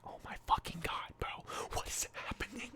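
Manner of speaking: hushed, breathless whisper of panic; panicked whisper. Retold or imitated. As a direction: whisper it like you're scared someone hears. Retold panic, unvoiced whisper.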